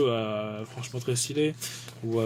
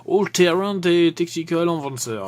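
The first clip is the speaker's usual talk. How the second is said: French-flavoured English accent, louder and higher; proudly overdone attempt at an English accent; 5 semitones higher, 5 dB louder than usual. Shown as a demonstration. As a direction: show off a hammy English accent. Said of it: "Mon accent, euh, anglais est, est fort bien".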